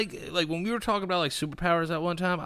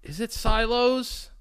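uncertain question; 9 semitones higher, slower than usual. Real, not acted. Unsure of the word.

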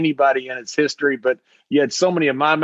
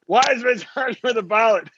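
laughing through the quote; 7 semitones higher than usual. Retold or imitated. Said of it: "Brian Gewirtz was there going".

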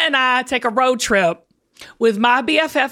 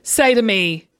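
usual speaking voice

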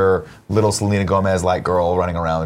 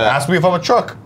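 Mark Wahlberg impression; 7 semitones higher than usual. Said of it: "draw my voice a little bit".

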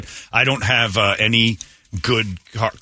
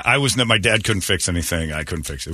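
usual speaking voice

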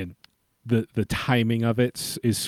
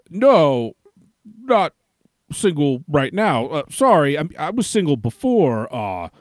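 loud, flustered, stumbling excuses; flustered stammering; 6 semitones higher, 5 dB louder than usual. Retold or imitated. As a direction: fumble through it, flustered. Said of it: "but he just, uh".

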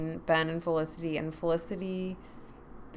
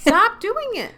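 exasperated shout; 14 semitones higher, 9 dB louder, slower than usual. Acted. Scolding a TV character.

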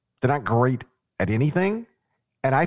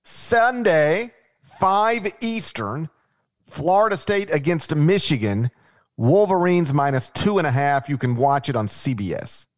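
raised, punchy matchup read, spaced out at the start; game announcer; 4 semitones higher than usual. Real, not acted. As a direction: announce the day, time and matchup like a TV promo.

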